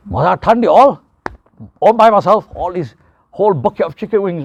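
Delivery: a glutton boasting about eating a whole bucket of wings. Retold or imitated. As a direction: ham up a proud overeater showing off. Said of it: "Bragging about what they can eat".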